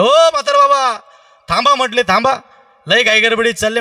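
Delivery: high, loud call after a passer-by. Acted. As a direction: call the old man back across the yard. Sadhu calls the old man.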